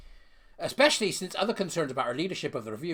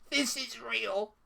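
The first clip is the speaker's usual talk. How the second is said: disbelief; 8 semitones higher than usual. Real, not acted. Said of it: "That's why I can't get past".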